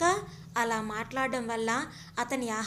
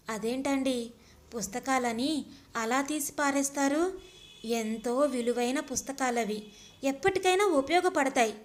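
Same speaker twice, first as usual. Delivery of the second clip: ordinary delivery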